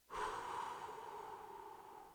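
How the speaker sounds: long, drawn-out breath out; long exhale. Real, not acted. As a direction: let out a long audible breath. Breath while recounting being hunted.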